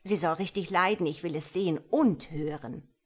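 loud and fast, relishing it; eager loud demand. A narrator's reading. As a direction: rattle it off loud, greedy for cruelty. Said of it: "rief Frau Kederfiel aus".

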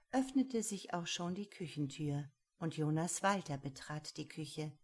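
usual speaking voice

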